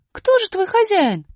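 высоко и громко, недоумевая; маленькая героиня возмущённо спрашивает; высокий удивлённый голос. Acted. Аля спрашивает. (высокий удивлённый голос героини)

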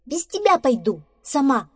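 high, loud, defiant huff; angry squirrel. Acted. Squirrel leaving badger behind, angry. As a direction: raise pitch and volume in a huff. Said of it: "рассердилась белка".

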